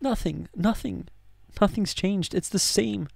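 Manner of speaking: hushed dismayed wonder; stunned realisation. Retold or imitated. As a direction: let it sink in quietly, amazed. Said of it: "And I was just like".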